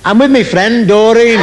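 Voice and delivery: hammed-up loud girl; brash girl at the bar. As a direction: shout back like a blunt barmaid.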